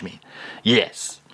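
ordinary talk, nothing marked

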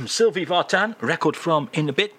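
ordinary talk

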